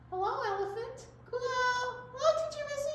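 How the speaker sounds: high, sweet puppet voice; a cheerful little puppet; Elephant puppet voice. Pretend. Puppet Elephant greets Teacher Missy, high and sweet.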